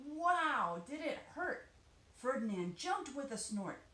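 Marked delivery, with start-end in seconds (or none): 0.1-1.7 exclaiming hurt. A narrator's reading. Bee stings Ferdinand.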